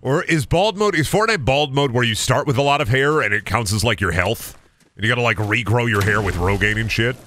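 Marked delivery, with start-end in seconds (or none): none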